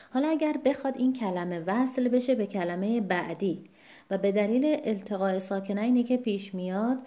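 ordinary talk, nothing marked

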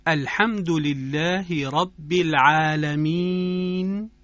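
slow chanted recitation of الحمد لله رب العالمین; Quranic recitation. Shown as a demonstration. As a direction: recite it slowly, with tajwid. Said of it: "به این عبارت توجه بفرمایید". (تلاوت آهسته و آهنگین با تجوید)

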